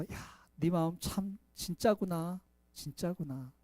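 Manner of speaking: God tenderly praising a sincere heart; tender praise. Acted. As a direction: affirm them gently.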